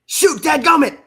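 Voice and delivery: high, loud exclamation in a put-on voice; Phillip Rivers impression. Co-host showing off his football player impression, high excited shout. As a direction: shout it out as a hammed-up quarterback. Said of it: "let me do my Phillip Rivers impression".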